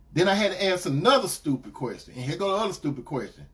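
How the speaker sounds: ordinary delivery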